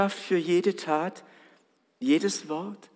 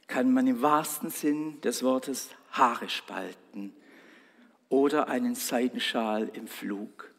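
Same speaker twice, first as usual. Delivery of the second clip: normal talking voice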